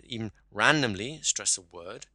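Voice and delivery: "randomly" stretched and punched, rising at the end on "word"; stress on RANDOMLY. Shown as a demonstration. Coach demonstrating random emphasis to a student, stress on "randomly". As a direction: lean slowly on "randomly", lift the last word. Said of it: "you've randomly stressed the word".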